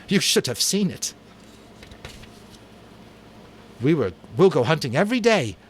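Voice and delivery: high, loud, quick eager boast; can't stop gushing about it; excited boast. Acted. Jack gushes to Ralph about hunting, excited.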